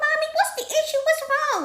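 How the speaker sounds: put-on very high little-kid voice; child's voice. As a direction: ask mommy in a small, high pitch. Child asking mom, retold.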